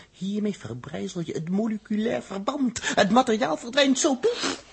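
high, loud excited burst; gleeful boast. Acted. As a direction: relish the destruction.

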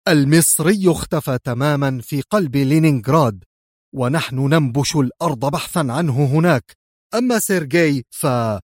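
plain reading voice

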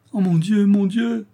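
high, loud wail of dismay; crying out. A narrator's reading. Daughter learns of the extra fees, distressed cry. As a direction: exclaim it far up in pitch, distressed. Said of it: "s'écria Louise".

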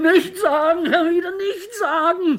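laut, hoch, fast weinend; panisches Flehen. Acted. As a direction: fleh ihn verzweifelt an. Flehen vor der Enthüllung. (panisch flehend, den Tränen nah)

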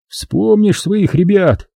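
high, slow, aching cry; strained anguish. A narrator's reading. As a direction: wrench it out in grief. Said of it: "с надсадной тоской".

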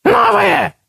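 very high shrill creature shout; whistling creature. A narrator's reading. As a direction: squeal it like a greedy beast. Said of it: "просвистел другой".

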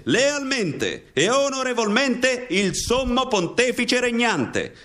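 slow, high, solemn recitation of a military oath; a young guard swearing loyalty before the pontiff, slow and solemn. Acted. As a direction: intone it like a sworn vow. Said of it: "[name] pronuncia il solenne giuramento".

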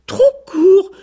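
high, loud outraged squawk; indignant exclamation. A narrator's reading. Vexed rooster answering the goose, a high indignant cry. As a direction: cry out, stung by the insult. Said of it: "s'écria le coq".

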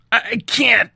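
high, strained stammer; gritted in pain. A narrator's reading. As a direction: force it out through gritted teeth. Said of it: "Sean gritted".

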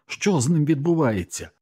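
high, urgent question; alarmed question. A narrator's reading. Narrator asking a comrade about the burning dog, alarmed question.

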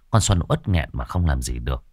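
ordinary delivery

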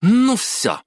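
very high, exploding exclamation; sudden high-pitched outburst of anger; angry flare-up. A narrator's reading. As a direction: burst out, patience gone. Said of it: "вспыхнула Майоко".

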